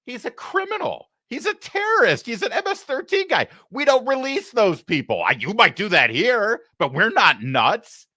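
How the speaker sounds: high, exasperated voice, slowing at the end; a leader mocking the reporters, incredulous; incredulous Bukele. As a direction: scoff at the question as absurd. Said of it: "And he's like".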